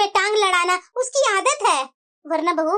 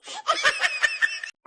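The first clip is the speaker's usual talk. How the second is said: high sly laugh at the bahu's expense; mocking cackle; 5 dB quieter than usual. Mother-in-law laughing slyly at the elder bahu. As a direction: cackle to mock her.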